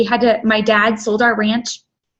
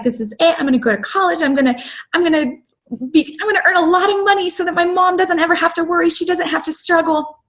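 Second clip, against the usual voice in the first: higher, fast and hopeful, rushing the plans out; eager young self; 7 semitones higher, faster than usual. Retold or imitated. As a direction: replay your college self, sure this is it. Younger self full of hope.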